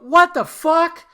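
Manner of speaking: loud, high, slow what the fuck; shocked outburst. Retold or imitated. He learns Josh is the cousin, loud shocked disbelief.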